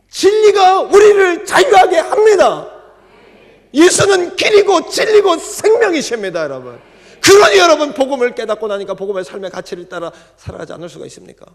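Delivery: loud, high proclamation; shouted preaching. Acted. Preacher proclaiming to the church, shouting. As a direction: ring it out to the room.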